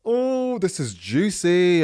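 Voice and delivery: gleeful high "ooh, juicy"; excited. Real, not acted. Gasp at juicy Reddit title.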